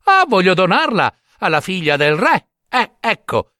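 high, pleased simpleton; proud eagerness. Acted. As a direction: announce the gift with naive pride. Simpleton proudly announces his gift.

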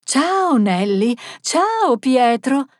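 high, loud singsong hellos; piercing, eager greetings from an elderly woman; old lady calling out. Acted. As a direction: hail passers-by in a shrill little voice. Old Teresa hailing passers-by.

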